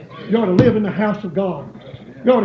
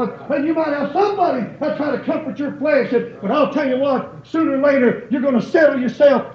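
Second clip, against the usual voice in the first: raised, higher preaching voice calling the congregation; full-force preaching; 5 semitones higher than usual. Acted. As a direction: build it up like a revival preacher.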